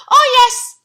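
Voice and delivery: very high, loud "Oh, yes"; squeaky pig. Acted. Horace answering Percy, very high squeal. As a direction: squeal it happily. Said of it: "Horace said".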